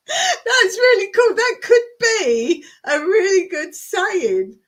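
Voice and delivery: high, loud, through real laughter; laughing. Real, not acted. Host tickled by a viewer's answer, laughing out loud. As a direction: crack up while praising it, pitch jumping up.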